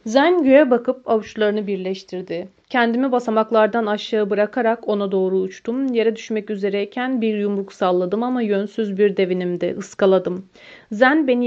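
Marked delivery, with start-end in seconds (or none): none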